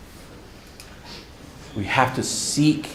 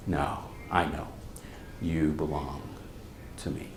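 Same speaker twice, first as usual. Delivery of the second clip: much lower, much softer tender hush; tender dad; 9 semitones lower, 16 dB quieter than usual. Retold or imitated. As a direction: hold him close and say it gently. Dad hugs son tightly.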